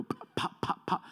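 breathy, voiceless pop pop of a bird at glass; soft imitated tapping. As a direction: tap it out softly with the lips. Bird hitting the window.

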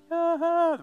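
high, loud drawn-out "ah"; gleeful kid's yell. Retold or imitated. Preacher imitating a kid running to the lake, high yell. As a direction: cry out like a kid running for water.

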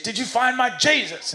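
high-pitched, hammed-up Southern preacher yell; mock Southern shout. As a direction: demand it like an exasperated Southern preacher. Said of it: "he shouts in his face".

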